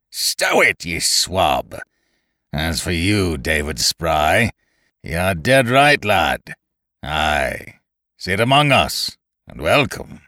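rough, scornful seaman's voice; curt seaman. A narrator's reading. Winter commanding the crew, gruff and curt. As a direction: order him silent, then welcome Spry. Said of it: "curtly and with irritated contempt".

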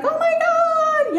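very high, slow, stretched "Oh my God"; gushing high squeal. Retold or imitated. Mom group leader gushing over her meditation, high stretched squeal. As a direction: squeal like a delighted mom friend. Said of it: "she was like".

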